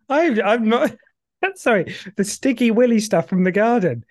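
giggling through the words; laughing. Real, not acted. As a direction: laugh while you talk. Giggling at "sticky willy.

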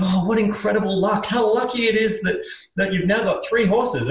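high, gushing congratulations; impressed neighbour. Acted. Neighbours congratulating the farmer on his horses, gushing. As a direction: marvel at someone's good luck. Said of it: "the neighbors look over and go".

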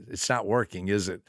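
low, soft, understated question; calm, not hateful. Retold or imitated. Adult to tantruming boy, quietly matter-of-fact. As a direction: quietly point it out without meanness. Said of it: "I wasn't ugly, mean, or hateful".

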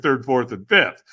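ordinary delivery

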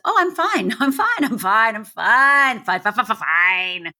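mock being fine, repeating it until it stutters out; overinsistent "fine". Outside self covering trauma from everyone, overinsistent mock reassurance. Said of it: "The outside me was".

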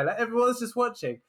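ordinary talk

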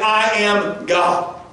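I am God drawn out slowly; slow emphatic. Shown as a demonstration. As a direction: weigh each word of "I am God". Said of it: "Jesus is saying".

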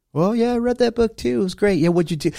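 high, breezy voice of someone bragging about a book; mock bragging reader. A person mocking a bragging reader in a high, breezy voice.

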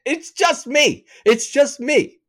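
high-pitched incredulous protest; comic high, worked-up rant; exasperated outburst. Retold or imitated. Exasperated rant at her question.

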